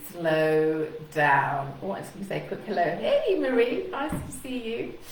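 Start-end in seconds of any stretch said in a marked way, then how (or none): 0.1-1.8 drawn-out, low, deliberate words; slowed down. Shown as a demonstration. Coach urges nervous speakers, slowly and deliberately. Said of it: "I'm really slowing my speech down". 2.8-5.0 high, singled-out hello; friendly greeting. Real, not acted. Coach breaks off to greet a viewer, high friendly hello.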